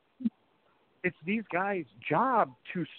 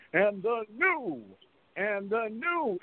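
drawn-out high announcer call; excited ring announcer; 7 semitones higher, much slower than usual. Retold or imitated. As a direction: stretch out and the new like an announcer. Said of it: "everybody was so excited to say".

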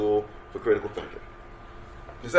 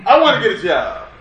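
job seeker plea; 9 semitones higher, 9 dB louder than usual. Retold or imitated. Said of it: "mimicking the job seeker".

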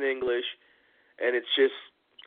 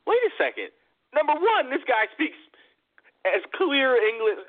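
surprised realization; 12 semitones higher than usual. Retold or imitated. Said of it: "somebody went".

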